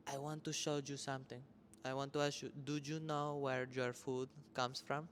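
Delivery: put-on a touch of a thick Argentine accent, slowing at the end; mother's Argentine accent. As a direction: lightly voice mom's Argentine accent as she quizzes her kid. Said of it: "Talks exactly like that".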